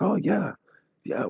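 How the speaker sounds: high, breezy friendly reply; imitating a cheerful British couple; mock friendly Brit.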